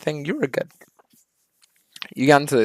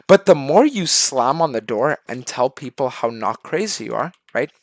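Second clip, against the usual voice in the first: ordinary delivery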